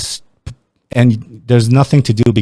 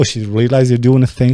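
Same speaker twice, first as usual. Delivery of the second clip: usual speaking voice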